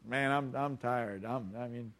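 low, soft, weary reluctance; acted sleepy mumbling, trailing away; tired excuse. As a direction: beg off as if too tired to go. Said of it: "you're gonna think".

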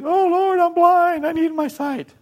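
very high, loud wailing plea; pleading blind man. Acted. Blind man begging Jesus for sight, hammed-up wailing. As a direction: cry out for help in a hammed-up high voice. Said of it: "Remember the blind guy comes to Jesus".

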